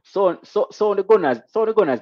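high, loud hype shout building on the Gunners; hyped up. Real, not acted. Streamer hyping a Gunners donation to chat, loud excited call. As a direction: hype it up like a stadium MC.

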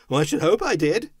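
high, loud, quick reply; lad's spirited shout; cheeky lad. A narrator's reading. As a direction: call back briskly as a boy. Boy calls back up.

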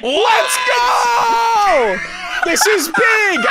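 victory shout. Real, not acted. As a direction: yell it out in real triumph. Celebrating the win.

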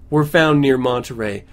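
ordinary talk, nothing marked

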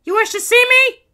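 very high, loud heroine voice; defiant princess. A narrator's reading. Princess Kitty confronting the Baron, defiant princess. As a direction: announce yourself as a cartoon princess.

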